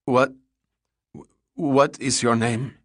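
halting, stammered question with a stretched repeat; stammering. A narrator's reading. Captive woman questions the gunman, stammering. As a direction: stumble on the first word, pause, then slowly ask. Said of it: "she stammered".

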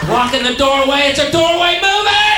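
very high, sustained shout; loud yelling. Acted. Yelling at people stuck in a doorway, loud screech. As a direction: holler it high at full force. Mocks tourists blocking doorway.

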